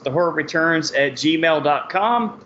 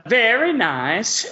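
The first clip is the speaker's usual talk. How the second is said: Borat-style catchphrase; 4 semitones higher, slower than usual. Acted.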